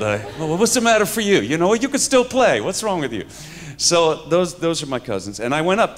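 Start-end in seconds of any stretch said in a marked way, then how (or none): 0.4-3.2 high, loud, fast Bronx scolding; mock Bronx protest, pitched up; Bronx cousins' accent. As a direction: imitate an indignant Bronx cousin. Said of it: "they talk like this".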